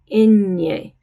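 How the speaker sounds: Enye with the ny said through the nose; nasal ny in Enye, like canyon. Shown as a demonstration. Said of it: "Enye is nasal".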